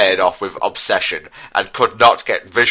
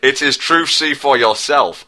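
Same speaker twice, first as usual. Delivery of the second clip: messenger; 5 semitones higher than usual. Acted. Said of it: "said the messenger".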